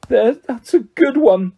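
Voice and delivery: put-on slow, high wailing ghost girl; howling. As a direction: wail it out bitterly. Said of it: "howled Myrtle".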